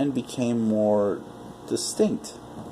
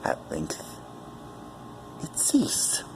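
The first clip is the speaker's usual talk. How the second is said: hushed whisper; 10 semitones lower, 9 dB quieter, slower than usual. A narrator's reading. Hushed reading of the death.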